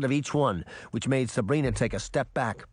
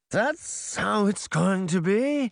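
high, drawn-out question; challenging villain; 8 semitones higher, slower than usual. A narrator's reading. Mirror reacts to Sabrina's refusal, slowly challenging. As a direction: stretch it out high, as a threat.

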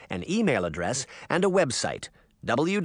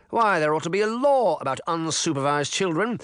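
pompous mayor; 6 semitones higher, 3 dB louder than usual. A narrator's reading. Mayor's original statement.

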